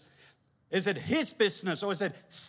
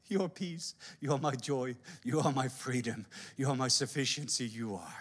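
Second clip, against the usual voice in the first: much softer, lower, intimate; tender worship; 7 semitones lower, 6 dB quieter than usual. Real, not acted. Worshipper answering God, soft and tender. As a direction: whisper your love to the Father. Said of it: "worship response to God".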